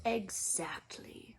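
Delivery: slow hushed agreement; Dumbledore, grave. A narrator's reading. Dumbledore answering McGonagall, hushed and grave. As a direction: drop it quietly and slow.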